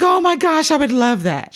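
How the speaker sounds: high, gushing retold delight; acting out an overjoyed reaction; thrilled. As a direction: gush with sudden excitement, pitch way up.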